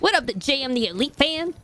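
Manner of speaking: hammed-up Southern accent with swooping pitch; Alabama accent. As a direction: channel an inner Southern girl with a jivey twang. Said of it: "Is this a better Alabama accent?".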